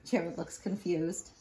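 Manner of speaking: ordinary delivery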